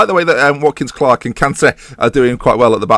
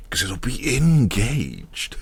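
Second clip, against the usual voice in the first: rebel punchline; 7 dB quieter, much slower than usual. Pretend. Punchline of his own joke, the rebel Gage in a slow deadpan. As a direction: land the pun slowly like a cool rebel. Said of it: "he sounds like a rebel".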